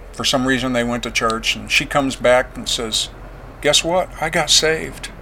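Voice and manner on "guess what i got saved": wife's announcement imitated, pitched higher; retold excited news. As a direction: mimic someone announcing big news in a higher voice.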